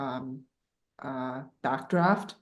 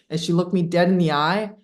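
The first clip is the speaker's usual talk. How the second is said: ordinary delivery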